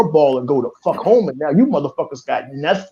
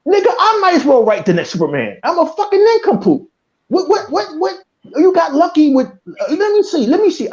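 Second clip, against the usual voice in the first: angry yelling; 10 semitones higher than usual. Real, not acted. Fan yelling at podcast co-host about studio. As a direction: let the anger burst out high. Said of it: "I apologize for yelling".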